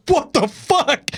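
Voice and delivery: high, slow shocked what the fuck; a drawn-out high yelp of disgust. Retold or imitated. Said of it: "he's like".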